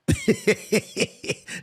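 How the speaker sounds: laughing at his own story; big laugh. Real, not acted. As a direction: crack up at the memory. Laughing at the quote.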